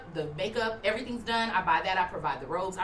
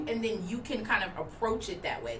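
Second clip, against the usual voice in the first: normal talking voice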